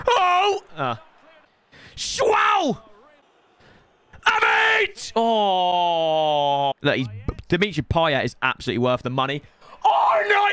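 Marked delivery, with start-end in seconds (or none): 0.1-6.7 excited shouts. Real, not acted. Big chance in the match. 9.8-10.5 high loud cry of dismay; anguished shout. Real, not acted. Conceding in the match.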